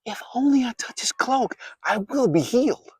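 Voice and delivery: high, hushed whisper with a tremble of tears; whispered to herself. Acted. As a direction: breathe it as a secret tearful hope. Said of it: "She said to herself".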